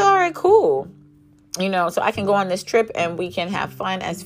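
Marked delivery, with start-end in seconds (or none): 0.0-0.9 high, light all right cool; a light relieved okay; breezy agreement. Retold or imitated. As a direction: toss it off casually, sounding relieved. Retelling her breezy agreement.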